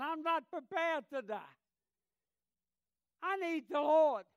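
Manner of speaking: retold screaming. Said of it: "And he is screaming".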